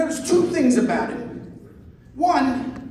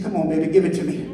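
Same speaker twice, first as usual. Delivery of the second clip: lightly voiced low come-on; low-pitched, mock sultry invitation; 7 semitones lower, faster than usual. Drunk date's come-on, acted.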